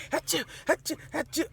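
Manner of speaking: playful sneeze imitation; imitated sneezes. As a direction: act out back-to-back sneezes.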